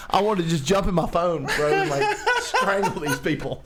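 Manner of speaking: ordinary talk, nothing marked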